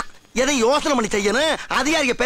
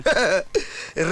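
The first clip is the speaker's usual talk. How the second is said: a short dismissive chuckle; scoffing laugh; 3 semitones lower than usual. Acted. Head constable laughing off his junior's warning, scoffing chuckle. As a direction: laugh off the risk.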